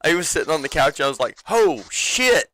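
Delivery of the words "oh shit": high, loud stunned exclamation; astonished reaction. Retold or imitated. Telling friends about the moment on the couch, astonished. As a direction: blurt it out, pitched way up.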